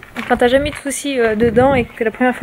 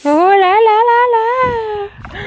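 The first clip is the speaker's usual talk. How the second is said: drawn-out amazed oh là là; slow, high, marvelling exclamation; excited; 10 semitones higher, 3 dB louder, much slower than usual. Real, not acted. Showing off the pool.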